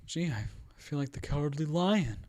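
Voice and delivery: timid, stammering put-on voice; Rocket Man movie character. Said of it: "he quotes the Cowardly Lion".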